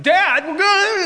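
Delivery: high, loud cry to a father; hammed-up panicked plea; alarmed son. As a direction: call out in mock alarm. Acting out bound Isaac alarmed.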